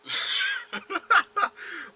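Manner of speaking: sudden high helpless laugh; loud real laugh breaking out; loud burst of laughter. Real, not acted. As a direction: crack up at the memory.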